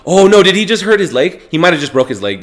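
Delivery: suddenly worried for the fighter; alarmed. Real, not acted. Fighter seems hurt on screen.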